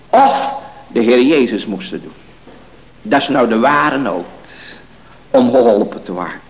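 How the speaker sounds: loud, emphatic sermon delivery; forceful preaching. Real, not acted. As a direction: press each point home from the pulpit.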